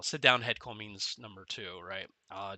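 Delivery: ordinary delivery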